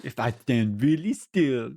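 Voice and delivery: slow, drawn-out cartoon firefly accent; Princess and the Frog firefly. Player voices hiding Florida man, cartoon firefly accent. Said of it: "too much like the, um, uh, Firefly".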